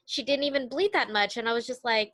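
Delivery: ordinary delivery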